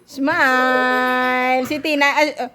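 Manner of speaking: ordinary delivery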